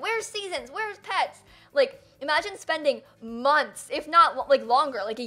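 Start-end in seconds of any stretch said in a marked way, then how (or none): 0.0-1.4 high nagging mimicry; mock nagging commenter. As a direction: nag like a trailer commenter. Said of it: "comments ... were always like".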